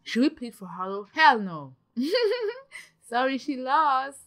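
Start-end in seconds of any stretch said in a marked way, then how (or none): 2.0-2.8 high-pitched giggle; guilty run of giggles; laughing. Real, not acted. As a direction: giggle when you should be sad. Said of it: "Why am I laughing?".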